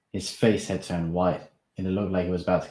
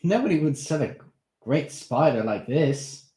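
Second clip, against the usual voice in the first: Darren's voice; 8 semitones higher, 6 dB louder than usual. Acted. Darren voiced in read story.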